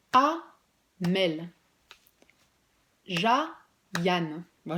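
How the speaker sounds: ordinary delivery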